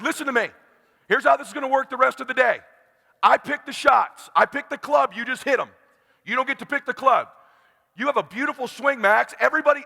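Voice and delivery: high, insistent orders; intense coaching. Retold or imitated. Dad taking charge of his son's game, intense. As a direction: drive each instruction home. Father orders son around.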